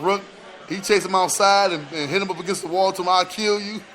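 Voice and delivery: usual speaking voice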